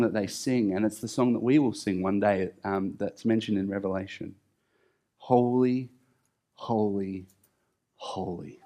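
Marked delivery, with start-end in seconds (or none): 5.2-8.6 each "holy" softer until barely breathed; quiet worshipful holy, dying away; hushed reverent echo. Acted. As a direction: fade it into awe. Echoing the angels' song, reverent.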